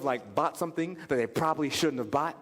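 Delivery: usual speaking voice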